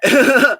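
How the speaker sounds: high burst of laughter; loud laugh. Real, not acted. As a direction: break into a big laugh. Laughs at own story.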